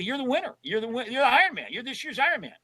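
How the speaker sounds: high, pumped-up praise for the winner; mock hype congratulations. Pranking the Ironman winner on the phone, high hyped praise. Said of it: "I said".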